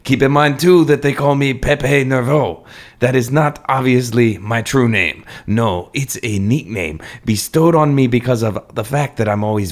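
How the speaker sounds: put-on hammy Cajun French accent. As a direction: play a nervous Cajun Frenchman. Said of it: "my incredibly accurate French accent".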